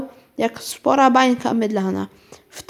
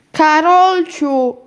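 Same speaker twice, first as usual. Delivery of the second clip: a long, loud, higher, slow call with a falling end; impatient call; 5 semitones higher, 6 dB louder, much slower than usual. Acted. Mother calls Karolcia ever more impatiently, loud. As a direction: call her name, losing patience. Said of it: "Głos mamy był coraz bardziej zniecierpliwiony".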